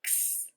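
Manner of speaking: x as a breathy unvoiced ks hiss; x sound. Shown as a demonstration. Teacher modelling the letter sound for kids, a whispered ks. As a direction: make x as a soft k then s, no voice. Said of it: "to represent the sound".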